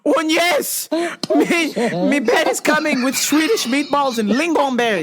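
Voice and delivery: put-on teasing herself with a silly Swedish accent; mock Swedish accent. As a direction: overdo a cheery Swedish voice. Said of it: "And I was like".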